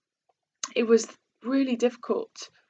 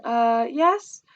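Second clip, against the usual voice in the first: nervous yes; 5 dB louder than usual. Retold or imitated. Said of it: "I sort of nervously said".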